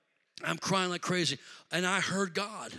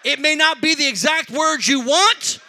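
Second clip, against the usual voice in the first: full-force preaching; 6 semitones higher, 14 dB louder than usual. Acted. Preacher urging the congregation off the floor, shouting. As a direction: call the whole room to get up.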